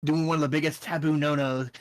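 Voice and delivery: ordinary talk, nothing marked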